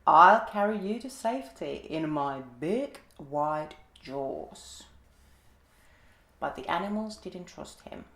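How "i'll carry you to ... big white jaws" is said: a crafty alligator luring prey. A narrator's reading. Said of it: "said the alligator".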